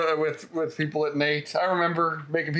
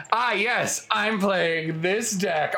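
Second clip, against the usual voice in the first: mock-smug, higher and drawn out; 3 semitones higher, much slower than usual. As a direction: play the self-satisfied opponent, savouring it slowly. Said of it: "all smug".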